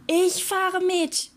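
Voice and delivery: high, slow seagull call. A narrator's reading. Seagull volunteering to join the pirate, calling out. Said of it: "rief die Möwe".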